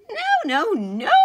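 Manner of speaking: very high, loud mouse squeak; an upset mouse refusing; indignant high squeak; squeaked protest. A narrator's reading. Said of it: "squeaked Mortimer".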